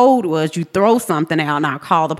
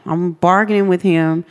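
ordinary talk, nothing marked

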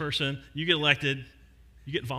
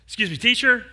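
higher and louder second attempt after clearing the throat; firmer retry; 7 semitones higher, 7 dB louder than usual. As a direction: speak up this time, politely insistent. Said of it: "clears throat".

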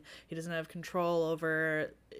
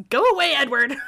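mock yell, louder and higher; all caps yell; 6 semitones higher, 12 dB louder than usual. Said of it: "I just wrote in all caps".

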